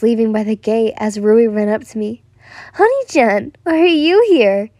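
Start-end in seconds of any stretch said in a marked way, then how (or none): 2.7-4.7 high, louder eager question; excited. A narrator's reading. Little brother spots me at school, excited. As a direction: greet eagerly, pitched up. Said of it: "he excitedly asked".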